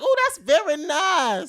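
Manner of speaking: high, loud, drawn-out mock delight; gushing admiration. Shopper admiring a Gucci shoe, hammed-up gushing. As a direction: coo loudly over it, slowly.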